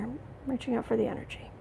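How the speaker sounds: hushed self-calming whisper before the reading. Real, not acted. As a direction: whisper fast as you focus inward. Calming down before a tarot read.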